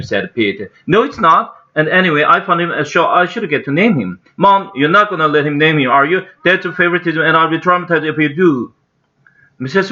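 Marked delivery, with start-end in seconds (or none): 0.9-4.2 put-on high, argumentative boy; insistent young boy. A narrator's reading. Toby arguing with his brother over the name, high and insistent. 4.4-8.7 lightly voiced high, complaining boy; a kid lodging a protest with mother. A narrator's reading. Boy objecting to his mom about favoritism, lightly pitched-up protest.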